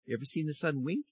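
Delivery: ordinary delivery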